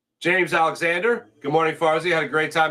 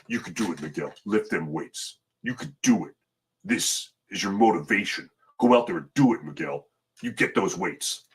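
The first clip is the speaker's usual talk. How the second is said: a lightly done, much lower gym-coach pep talk; mock gym coach; 6 semitones lower, 4 dB quieter than usual. Host to a viewer at the gym, low mock coach pep talk. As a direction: growl encouragement at a lifter, half joking. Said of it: "Is that good?".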